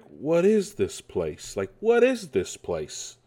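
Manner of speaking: slow, drawn-out mimicked movie line; Mario impression. Mimicking Mario's first line in the trailer, drawn-out impression. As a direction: stretch it out in mock wonder. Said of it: "it sounds like".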